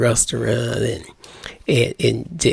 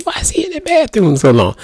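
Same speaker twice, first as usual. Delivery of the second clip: much higher, fast, puzzled wife voice; impatient wife; 11 semitones higher, faster than usual. Retold or imitated. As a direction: rattle it off as the waiting wife, voice up high. Wife wondering about husband in bathroom.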